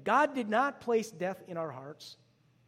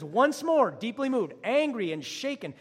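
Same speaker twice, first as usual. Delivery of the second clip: higher and louder scripture read, punched; forceful reading; 5 semitones higher, 7 dB louder than usual. Real, not acted. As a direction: declaim the verse with heat.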